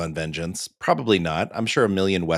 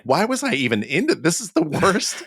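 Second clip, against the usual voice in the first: embarrassed; 6 semitones higher than usual. Retold or imitated. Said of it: "I started being super embarrassed, like".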